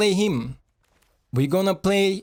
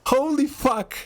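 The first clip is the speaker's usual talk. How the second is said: much higher, slower, stunned delight; ecstatic disbelief; 8 semitones higher, slower than usual. Real, not acted. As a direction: gush like you can't believe it worked. Guys, I'm gonna cry.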